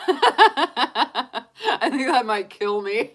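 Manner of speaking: words squeezed out through laughter; laughing too hard to talk straight. Real, not acted. Tarot reader laughing to viewers, breaking up with laughter. Laughing at snow jumping idea.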